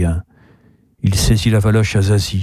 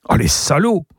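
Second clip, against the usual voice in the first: higher, rising exclamation; indignant cry; 6 semitones higher than usual. A narrator's reading. Niece hears of the métro strike, indignant cry. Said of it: "s'écrie Zazie".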